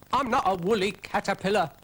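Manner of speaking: high, loud indignant snail; protest, squeaky and offended; Brian the snail. Acted.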